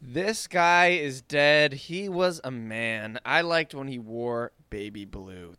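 very slow, solemn recital of a haiku; recited haiku. Pretend. Co-host recites a mock haiku for Sean Connery, slow and solemn. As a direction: intone each line like a mock tribute poem. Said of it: "That's a haiku for you".

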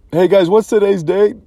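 high, sing-song question to his daughters; teasing dad voice. Retold or imitated. Dad teasing his three daughters with a question, innocently high. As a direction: innocent dad fishing for the date. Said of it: "the dad's like".

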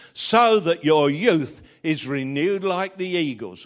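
loud, ringing scripture reading; scripture recitation. Acted. Preacher reciting the psalm to his congregation, loud and ringing.

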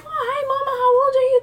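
high-pitched mock coo to a child; cooing neighbor. Mom mimicking the neighbor talking to her daughter, high mock coo. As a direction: imitate a grown-up fussing over a little kid.

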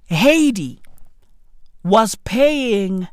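normal talking voice

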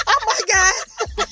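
high, slow mock-mortified groan; dismayed wail. Dater caught by stranger's answer, dismayed wail.

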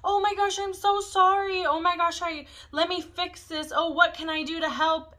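high, loud, over-eager apologizing; gushing apology. Retold or imitated. To people she offends, a high gushing mock apology. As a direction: fall over yourself saying sorry. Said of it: "I kinda wanna, like, be like".

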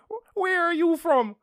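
put-on loud, slow, very high old-lady voice; old woman. Old woman complimenting a stranger's English, high mock voice. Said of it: "this very old woman next to me".

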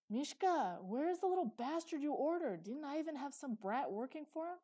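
put-on loud, high, swooping drunken yell; shouted call. A narrator's reading. Drunk customer yelling for the boy he ordered, shouted call. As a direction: bellow impatiently across the room. Drunk man calls out from bathhouse.

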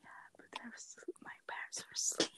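hushed late-night whisper. Real, not acted. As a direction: whisper so nobody wakes. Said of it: "so I'm whispering".

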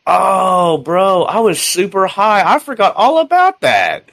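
high, slow, drawn-out stoner impersonation; imitated stoner. Recruit admitting he forgot, imitated stoner realization. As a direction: stretch it out like a spaced-out stoner.

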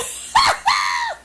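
real burst of high laughter; cracking up at the story; high shrieking laugh. Real, not acted.

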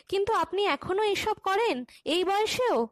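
high-pitched, mildly amazed question; surprised. A narrator's reading. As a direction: lift the pitch in admiring disbelief. Former pupil amazed at teacher's work.

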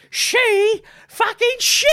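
put-on high, slow slurring drunk woman; drunk woman impression. Mocking the drunk heckler's wife, high and slurred. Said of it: "like this".

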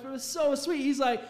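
usual speaking voice

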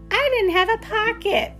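put-on high, innocent child voice; little kid voice. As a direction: answer like a small child caught out. Child answering the school librarian.

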